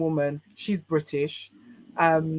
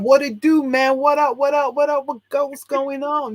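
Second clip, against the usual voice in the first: hammed-up American slang accent, much higher and louder; bad American accent; 9 semitones higher, 5 dB louder than usual. British guest shows off her awful attempt at an American accent. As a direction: overdo a terrible American accent, pitched high and loud. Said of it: "My American accent is shocking".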